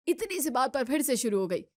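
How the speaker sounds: ordinary delivery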